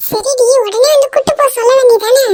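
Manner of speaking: high-pitched, pressing reproach; exasperated scolding. Acted. Captive girl chides her friend for not demanding rescue, exasperated. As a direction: push it up high, chiding a friend.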